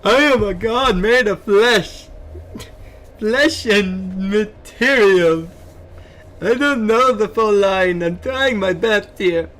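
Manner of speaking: high, slow, grand godlike voice; improvised villain line. Acted. To chat, improvising a line as a grandiose god. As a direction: declaim like a self-made deity. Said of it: "I'm improvising".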